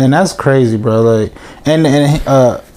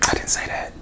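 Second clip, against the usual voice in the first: whispering; 14 dB quieter than usual. Real, not acted. Aside correcting his partner.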